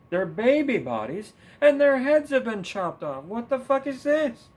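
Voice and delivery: high, loud hammed-up excitement counting babies; mock excited Biden. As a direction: ham up a politician's breathless alarm. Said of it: "were you there like".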